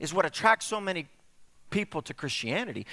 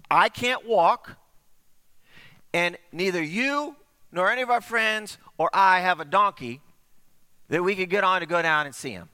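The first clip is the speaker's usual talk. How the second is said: exasperated objection; 4 semitones higher, 6 dB louder than usual. Acted. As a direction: protest he can't get there. Man on mat objects.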